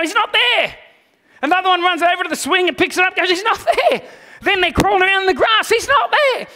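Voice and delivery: put-on high, loud puzzled child voice; searching kids. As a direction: call out like little searchers.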